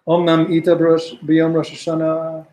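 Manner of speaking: slow, deliberate reading of the source; slow text reading. Real, not acted. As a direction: lay out the source line word by word. Reading the Hebrew source aloud.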